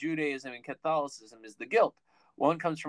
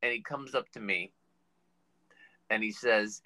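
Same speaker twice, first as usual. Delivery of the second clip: normal talking voice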